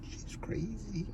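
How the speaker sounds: hushed whispered aside; whispering. Real, not acted. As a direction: whisper it so she can't hear. Aside about mom, joking.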